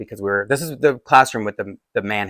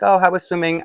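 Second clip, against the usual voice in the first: lightly imitated mom, higher pitched; cheery mom greeting; 8 semitones higher than usual. Mom in the kitchen asking her child, cheery greeting. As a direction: ask it in a higher, chirpy mom voice.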